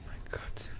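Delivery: scared breathless whisper. Real, not acted. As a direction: breathe it out in fear. Horror game, frightened. (ängstliches Flüstern)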